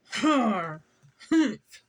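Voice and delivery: loud gruff har and humph; gruff grumble. A narrator's reading. Grumpy museum owner grunts his grudging agreement. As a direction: grunt it like a grumpy old man. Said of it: "said Mister Frizzle".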